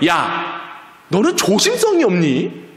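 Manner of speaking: high, exasperated telling-off, sighing down at the end; scolding mother. Acted. Mother to child who spilled water, scolding. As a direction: act out nagging a careless child.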